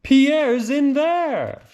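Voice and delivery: high, slow and loud; father's slow answer. A narrator's reading. Father tells mother, slow, high and loud. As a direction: drag it out, pointing at the lion.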